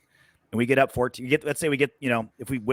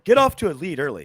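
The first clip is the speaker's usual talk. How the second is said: louder, higher mock-analyst voice; mocking pundit; 5 semitones higher, 5 dB louder than usual. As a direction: make fun of a pundit's obvious advice. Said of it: "the thing I was making fun of".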